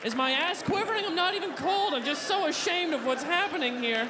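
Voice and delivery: mortified and whiny; squatting dog cringing with shame; ashamed dog. Acted. Dog continuing shamed monologue.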